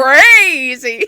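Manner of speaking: loud cartoon echo of crazy; vintage cartoon voice. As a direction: shout crazy like a cartoon host. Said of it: "You said it like a cartoon".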